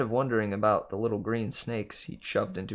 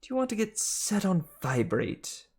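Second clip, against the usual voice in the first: Martha the snake; 9 semitones higher than usual. Acted. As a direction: brush George off sweetly. Martha threatening George.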